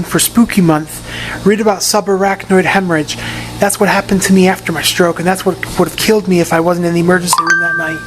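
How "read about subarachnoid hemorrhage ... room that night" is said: ordinary talk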